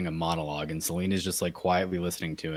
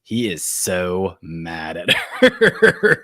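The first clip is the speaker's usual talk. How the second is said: laughing; 7 dB louder, much slower than usual. Real, not acted. Laughing at the comic panel.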